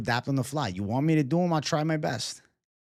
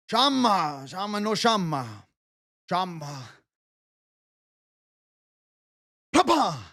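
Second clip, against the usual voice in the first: Alex Pereira impression; 3 semitones higher than usual. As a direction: wail like a fighter just poked in the eye. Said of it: "Impression of Alex Pereira after getting eye poked".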